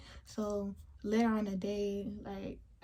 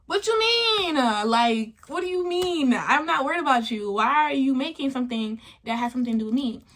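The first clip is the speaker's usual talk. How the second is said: indignant outburst; 2 semitones higher, 7 dB louder than usual. Retold or imitated. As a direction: fire back in outraged disbelief. Retelling her angry confrontation.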